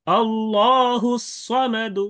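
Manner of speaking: slow, high chant ending "সামাদু" with a light u; rum stop recitation. Shown as a demonstration. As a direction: recite slowly, lightly voicing the final damma in "সামাদু". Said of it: "এটা হালকা উচ্চারণ করবে". (রুম করে ধীর তিলাওয়াত, "সামাদু"-তে হালকা পেশ)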